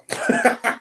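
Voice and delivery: high burst of laughter; disbelieving high laugh; amused laugh. Real, not acted. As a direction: crack up mid-story. Laughs at his own story.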